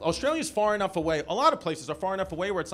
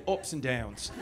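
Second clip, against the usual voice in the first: lower, put-on Australian accent; 7 semitones lower, 4 dB quieter, slower than usual. Shown as a demonstration. Comic teasing Australian audience member, mock Australian accent. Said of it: "as you would say".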